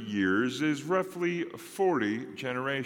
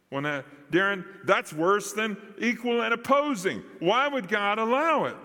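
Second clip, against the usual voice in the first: higher-pitched challenge from an imagined listener; imagined objection; 7 semitones higher than usual. Acted. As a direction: push back like a puzzled objector.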